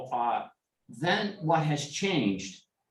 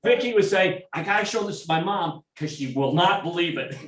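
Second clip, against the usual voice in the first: higher and louder, still chuckling; laughing while telling; 7 semitones higher, 5 dB louder than usual. Real, not acted. Retelling his neighbor's delight, higher and louder through laughter. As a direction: tell it through leftover laughter.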